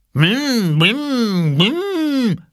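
high, playful blim blim noise; toddler engine noise. A narrator's reading. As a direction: make a toy truck sound like a little kid. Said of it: "machte er".

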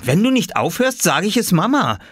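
put-on high-pitched childish threat; scolding child. Boy threatens to tell on his brother, childish scolding. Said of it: "schimpfte Oskar".

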